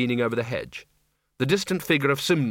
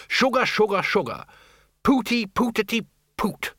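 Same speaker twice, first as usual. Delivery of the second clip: mock trial swears; 9 semitones higher than usual. Arch-Chancellor tries the harmless swears on the wizards, sing-song. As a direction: test each word like it tastes odd.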